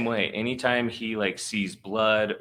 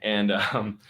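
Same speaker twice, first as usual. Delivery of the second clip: normal talking voice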